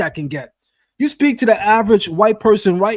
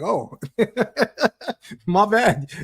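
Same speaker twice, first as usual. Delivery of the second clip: laughing mock apology; 3 semitones higher, slower than usual. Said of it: "I'm like".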